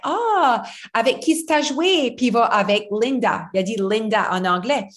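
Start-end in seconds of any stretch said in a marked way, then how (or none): 0.0-2.1 higher mock mom voice in French; lightly voiced mother asking sweetly; imitating mom. Mom asking Noah who he played with, lightly imitated. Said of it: "my mom was like".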